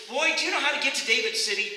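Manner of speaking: lightly acted brisk, loud questioner; impatient traveler. Acted man asking boy directions.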